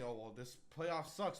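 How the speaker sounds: ordinary talk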